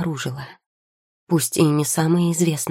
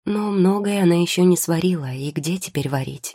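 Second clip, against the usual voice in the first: usual speaking voice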